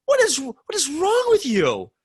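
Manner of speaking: mild mock disbelief; shocked outrage. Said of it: "I was like".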